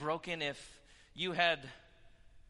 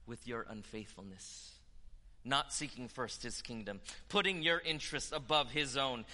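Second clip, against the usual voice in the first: usual speaking voice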